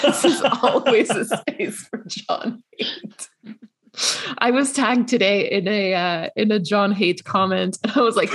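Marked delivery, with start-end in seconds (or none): 0.0-3.3 drawn out through real laughter; laughing. Real, not acted. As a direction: say it slowly while cracking up. Joking along with co-host.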